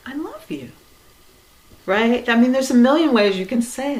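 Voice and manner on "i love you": higher, softer, airy I love you; a high, soft, exaggerated tender I love you; sweet I love you. Shown as a demonstration. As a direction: coo it with a put-on sweetness. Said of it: "you're like".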